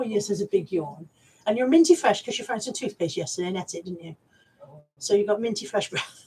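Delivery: ordinary talk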